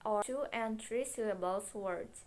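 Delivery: ordinary delivery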